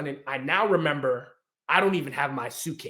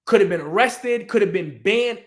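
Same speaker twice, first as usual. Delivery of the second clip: loud, raised, hard-hit phrases; emphatic; 6 semitones higher, 9 dB louder than usual. Real, not acted. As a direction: drive each point home at high volume. Emphatic point about privilege.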